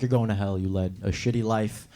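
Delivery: low, calm verdict from God; lightly voiced deep judge. God telling the slave owner he's going to hell, low and calm. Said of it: "And God's like".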